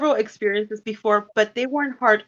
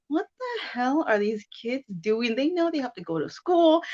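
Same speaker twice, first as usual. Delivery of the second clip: exasperated parent; 5 semitones higher, 5 dB quieter than usual. Retold or imitated. Retold tired parent grumbling about kids.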